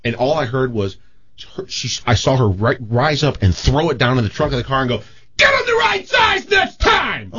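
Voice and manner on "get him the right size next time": very high, drawn-out mock screech; furious yell. Host mimics drunk girlfriend berating vice president, screeched yell. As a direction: imitate an outraged screamer, stretching the words out.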